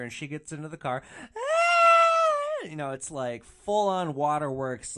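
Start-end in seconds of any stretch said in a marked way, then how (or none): 1.4-2.6 piercing high shriek; hammed-up wailing scream, far louder and higher; loud mock wail. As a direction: let out an exaggerated wail. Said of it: "the wailing is getting louder and louder".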